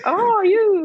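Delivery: a playful bird-like call; mock bird call. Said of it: "He might think you're a bird".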